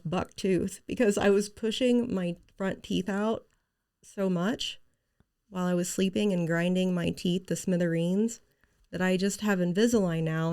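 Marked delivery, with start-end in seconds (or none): none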